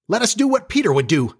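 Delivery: high-pitched rallying cry; rally the others in a high voice; eager resolve. A narrator's reading. Boys facing wolves agree on a plan, high eager cry.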